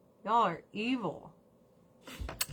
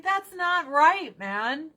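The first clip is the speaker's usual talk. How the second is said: slow, higher, emphatic scolding; indignant; 8 semitones higher, 6 dB louder, slower than usual. Retold or imitated. Speaker tells the Dodgers off, slow indignant scolding. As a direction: lay it out slowly, disappointed. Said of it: "and I'm like".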